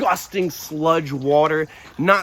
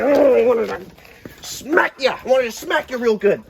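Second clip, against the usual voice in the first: mock threat; 7 semitones higher, 4 dB louder, slower than usual. Mock threat to naughty dog.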